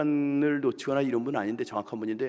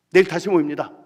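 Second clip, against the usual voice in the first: stern loud announcement; 3 semitones higher, 11 dB louder than usual. Retold or imitated. Chairwoman announcing angrily.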